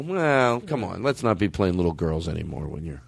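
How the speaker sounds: ordinary talk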